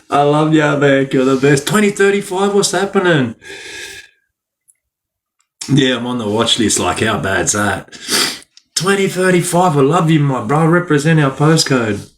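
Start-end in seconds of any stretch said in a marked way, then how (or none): 1.7-3.3 higher, pumped-up postcode shout-out; excited shout-out. Real, not acted. 8.7-12.1 higher, pumped-up postcode shout-out; excited shout-out. Real, not acted.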